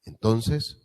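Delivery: ordinary delivery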